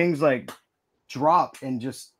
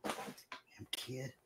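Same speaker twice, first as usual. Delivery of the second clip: much softer, breathy grumble; muttered curse; 19 dB quieter, much slower than usual. Retold or imitated. Shopper annoyed by ghost kid, soft muttered curse. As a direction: grumble it under your breath. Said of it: "I was like".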